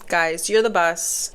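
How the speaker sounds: ordinary talk, nothing marked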